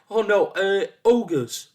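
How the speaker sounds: high, loud second guess; guessing, flustered. A narrator's reading. Forgetful son guessing his errand again, flustered. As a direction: correct yourself loudly and guess high. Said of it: "tried again".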